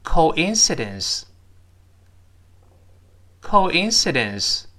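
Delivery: "coincidence" said slowly, drawn out syllable by syllable; model pronunciation. Shown as a demonstration. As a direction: read "coincidence" slowly and clearly for learners to repeat. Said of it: "我们读一下这个词". (慢速清晰示范读 coincidence)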